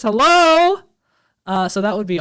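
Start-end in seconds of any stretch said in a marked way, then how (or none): 0.0-0.8 excited. Real, not acted. Cheering the news of a sequel.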